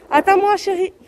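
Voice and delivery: calling out. Real, not acted. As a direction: call out after her. Calling to her daughter. (appel aigu et fort)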